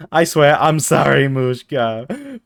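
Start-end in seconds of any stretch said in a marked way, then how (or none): none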